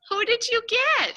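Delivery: light up for the little one. Real, not acted. Visitor excitedly addresses toddler.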